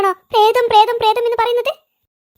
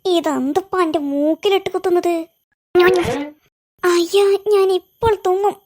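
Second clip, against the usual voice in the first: groggy low voice; 6 semitones lower than usual. Acted. Another character wakes, tickled.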